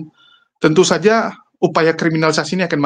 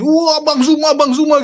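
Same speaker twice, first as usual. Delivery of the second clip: hysterical fan; 8 semitones higher, slower than usual. Retold or imitated. Imitating Bu Darni meeting Bang Zuma, higher hysterical squeal. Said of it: "Histeris langsung".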